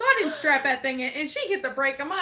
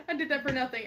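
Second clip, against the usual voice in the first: ordinary delivery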